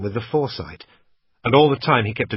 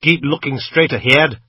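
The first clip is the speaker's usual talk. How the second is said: menacing order; 4 semitones higher, 7 dB louder than usual. A narrator's reading. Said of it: "he said".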